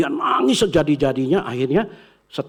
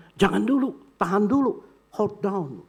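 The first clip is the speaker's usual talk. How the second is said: higher urgent plea to wait; higher, a lightly voiced urgent call; calling out to stop; 7 semitones higher than usual. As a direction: stop them before the button is pressed.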